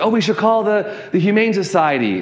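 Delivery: imitated woman bystander. Woman suggesting help for the stray, imitated bystander. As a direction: lightly voice a woman pitching in. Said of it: "one woman said".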